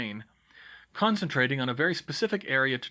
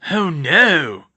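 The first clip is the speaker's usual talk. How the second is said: loud, drawn-out dismay; big man aghast at bad news; horrified; 4 semitones higher, 12 dB louder than usual. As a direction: cry out in horror. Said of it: "he said in horror".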